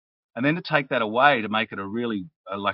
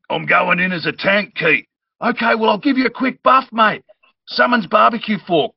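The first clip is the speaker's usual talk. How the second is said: high, loud battle banter; character voices; 10 semitones higher, 8 dB louder than usual. Retold or imitated. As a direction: voice the party mid-battle. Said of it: "I could hear the battles".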